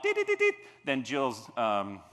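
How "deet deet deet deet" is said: high loud electronic beep; stopwatch beeps. Shown as a demonstration. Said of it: "stopwatch will beep very loud".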